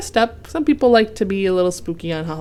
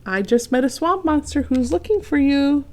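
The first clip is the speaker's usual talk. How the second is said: higher, friendly flower voice; sweet little daisy calling out; lightly voiced cheerful daisy; 8 semitones higher, 3 dB louder than usual. A narrator's reading. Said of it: "said a friendly daisy".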